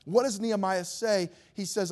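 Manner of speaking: ordinary talk, nothing marked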